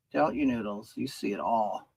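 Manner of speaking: ordinary talk, nothing marked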